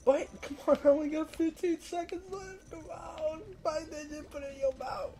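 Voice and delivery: desperate high-pitched begging; frantic pleading. Real, not acted. Timer running out, begging.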